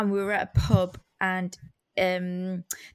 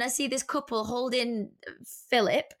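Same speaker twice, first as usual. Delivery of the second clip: usual speaking voice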